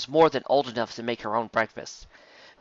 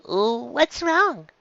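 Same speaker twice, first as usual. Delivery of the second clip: concerned mare; 10 semitones higher than usual. A narrator's reading. Dizzy voiced.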